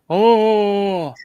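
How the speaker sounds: loud, drawn-out "oh" yell; loud open shout. Shown as a demonstration. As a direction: holler a long, full-voiced "oh". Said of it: "not like".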